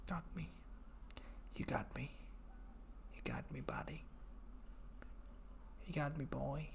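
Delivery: whispered "you got me, buddy". Real, not acted. Conceding to a joke with a soft whisper. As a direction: breathe it out as a whisper.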